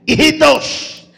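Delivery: high, drawn-out call; acted hail from afar. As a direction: call to men out on the water. Jesus calls out to fishermen.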